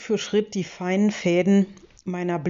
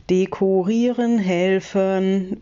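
slow, syllable by syllable; sounding out words; 3 dB louder, slower than usual. A narrator's reading. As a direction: spell it out slowly, each syllable apart. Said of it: "wiederhole ich Silbe für Silbe". (Silbe für Silbe, langsam und deutlich)

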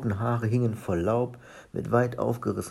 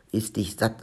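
usual speaking voice

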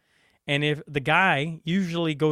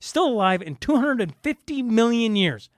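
ordinary delivery

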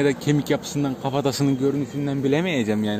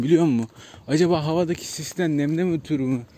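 normal talking voice

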